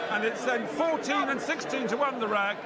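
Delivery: ordinary talk, nothing marked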